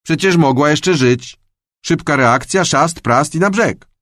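high, huffy indignant voice. A narrator's reading. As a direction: defend yourself, offended. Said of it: "sapnął oburzony".

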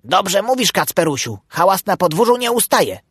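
high, eager echo; high-pitched dutiful duck; agreeing duck wife. A narrator's reading. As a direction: chime in approvingly with your husband. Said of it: "przytwierdziła Melancia, która zawsze zgadzała się".

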